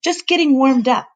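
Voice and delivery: high, cheery announcement; grinning. Acted. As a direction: announce it with a grin. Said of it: "he announced with a grin".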